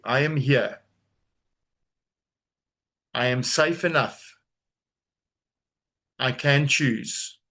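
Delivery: slow, deliberate affirmation; said slowly. Shown as a demonstration. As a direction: let each word land slowly. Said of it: "say this slowly".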